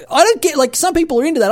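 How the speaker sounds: high, loud, flustered burst; embarrassed high-pitched protest; flustered squeal. Real, not acted. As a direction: squirm and squeak out the words. Flustered about vampire lady.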